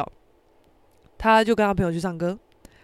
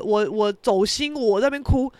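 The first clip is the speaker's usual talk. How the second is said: usual speaking voice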